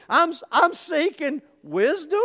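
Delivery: high, stammered answer; acted flustered, high-pitched reply; sputtering, upset. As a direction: stammer it out high, just up from underwater. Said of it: "sputtering and spitting and all upset".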